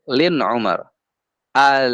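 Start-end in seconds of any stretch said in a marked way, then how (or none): 1.5-1.9 "Al" with the l lingering and fading; half-flowing sound. Shown as a demonstration. Teacher shows bainiyah, "Al" gently trailing on the l. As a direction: let the l of "Al" ring briefly, then die away. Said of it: "Jangan al".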